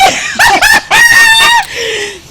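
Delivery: loud, high scornful cackle; scornful laugh. Acted. Rival laughs before threatening.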